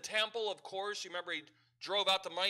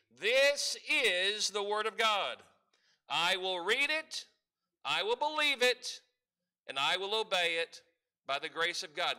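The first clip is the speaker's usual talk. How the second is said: congregational declaration; 5 dB louder than usual. Shown as a demonstration. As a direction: declare it proudly for the church to repeat. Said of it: "Repeat with me or say with me".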